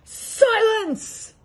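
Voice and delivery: high, loud barking shout; barked command. A narrator's reading. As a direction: bark it at him like a tyrant. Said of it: "barked back".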